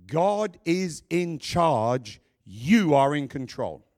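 slow and loud, "God" and "You" punched; emphatic declaration. Shown as a demonstration. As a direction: lay down each word like a verdict. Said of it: "I want to say this emphatically".

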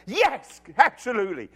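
loud, emphatic acted yes; emphatic agreement. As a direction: agree with hearty, played-up enthusiasm. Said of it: "And he's going".

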